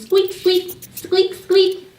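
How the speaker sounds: high, short mouse squeaks; rising to a high-pitched squeak. Shown as a demonstration. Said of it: "the sound of a mouse is".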